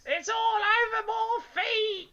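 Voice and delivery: very high, slow, crying shriek; terrified scream. A narrator's reading. Missus Twit shrieks in bed at the frog, crying. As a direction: shriek for help in pure panic. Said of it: "screamed Missus Twit".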